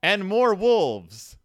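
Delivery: loud, high, slow build-up; dramatic story reading. Acted. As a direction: announce the wolves with mock dread. Dramatic reading of page fifteen.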